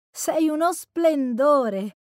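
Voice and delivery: high, loud, drawn-out compliment; shout over music. A narrator's reading. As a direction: raise your voice over loud music, slowly. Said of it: "gridò per sovrastare la musica". (gridato per sovrastare la musica)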